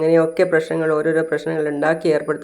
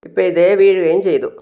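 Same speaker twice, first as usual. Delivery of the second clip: normal talking voice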